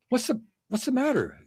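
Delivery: wife's voice. Acted. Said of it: "And his wife says".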